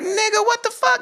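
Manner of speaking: very high, squealed disbelief; retold freak-out. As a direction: act out freaking out, pitch shooting up. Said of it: "we're freaking like".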